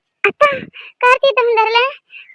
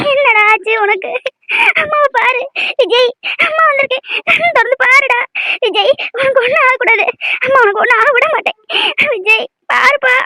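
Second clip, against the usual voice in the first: tearful pleading, a little raised; crying; 3 semitones higher than usual. Acted. Mother begging her injured son to open his eyes, crying. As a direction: plead through sobs for him to wake. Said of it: "அழாதம்மா".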